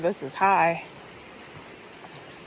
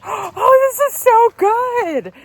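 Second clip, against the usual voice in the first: much higher, thrilled gush; delighted; 11 semitones higher, 4 dB louder, much slower than usual. Retold or imitated. Delighted by dream song.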